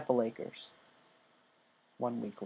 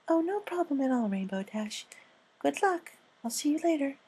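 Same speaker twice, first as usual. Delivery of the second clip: Fluttershy voice; 14 semitones higher than usual. Acted. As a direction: speak like a shy gentle mare.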